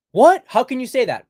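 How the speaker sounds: high, loud mock outrage; shocked objector. Woman objecting to being told her place, mock shock. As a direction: jump up in pitch, scandalized.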